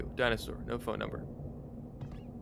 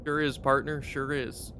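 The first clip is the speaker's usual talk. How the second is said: cowboy drawl; 3 semitones higher than usual. Acted. Cowboy character continues.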